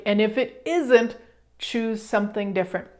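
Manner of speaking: normal talking voice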